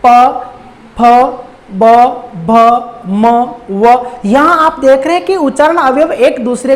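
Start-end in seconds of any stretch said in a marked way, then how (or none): 0.1-4.1 प फ ब भ म व each said crisply with lips closing; teacher contrasts lip consonants with vowels; lip consonants demonstrated. Shown as a demonstration. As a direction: pause after each consonant. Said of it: "अब मैं एक अलग वर्ण बोल रहा हूं".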